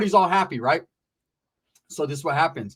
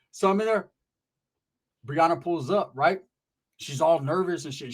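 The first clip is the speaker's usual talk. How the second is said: ordinary talk, nothing marked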